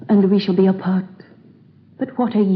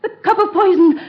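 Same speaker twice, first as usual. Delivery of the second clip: anguish; 8 semitones higher than usual. Acted. Queen finds lover poisoned.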